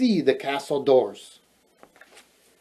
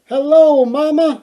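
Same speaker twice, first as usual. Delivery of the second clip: princess voice; 12 semitones higher, 7 dB louder than usual. Acted. Princess greeting her mother.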